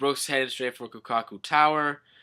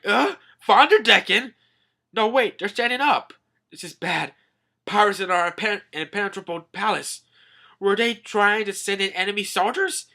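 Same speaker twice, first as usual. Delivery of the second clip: raised, high questions building to a shout; acted alarm; 8 semitones higher, 7 dB louder than usual. Soldier sees pirates inside the palace, mounting alarm. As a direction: work up into a loud warning.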